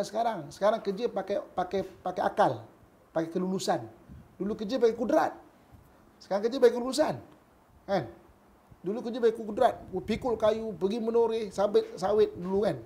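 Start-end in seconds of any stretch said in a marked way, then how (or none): none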